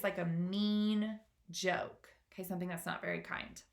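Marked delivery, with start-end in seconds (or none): none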